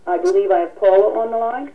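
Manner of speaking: ordinary talk, nothing marked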